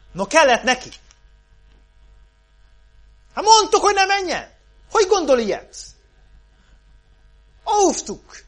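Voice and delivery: acted reproach, high and loud; scolding boatmates. Men in the boat on Peter sinking, acted loud scolding. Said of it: "vajon mit mondhattak a csónakban levők".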